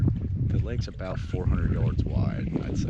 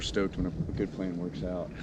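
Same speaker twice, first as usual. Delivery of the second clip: talking soft; 8 dB quieter than usual. Real, not acted. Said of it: "I'm talking kinda soft".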